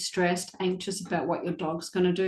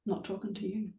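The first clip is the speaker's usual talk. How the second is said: cold silent treatment; 9 dB quieter, faster than usual. Retold or imitated. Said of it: "he was just like".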